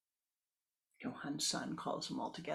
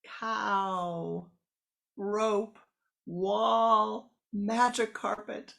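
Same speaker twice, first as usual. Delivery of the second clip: louder and higher shouted words; hammed-up shouting match; yelling; 4 semitones higher, 9 dB louder, much slower than usual. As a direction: shout each one over the others. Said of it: "filled with their yelling".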